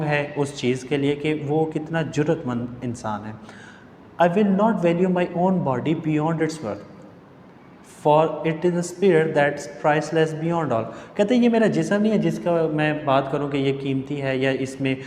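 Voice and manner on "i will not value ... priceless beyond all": very slow, deliberate recitation; quoted speech read aloud. Acted. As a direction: read it out slowly with long pauses. Reads the character's quoted English speech. (बहुत धीमे, ठहरकर पाठ पढ़ना)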